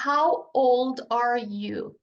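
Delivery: each word slow and clear; slow teaching demo. Shown as a demonstration. Teacher models the question slowly for students. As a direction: spell it out slowly for learners. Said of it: "A segunda pergunta é".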